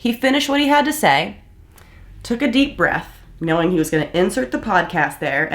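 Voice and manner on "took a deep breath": ordinary talk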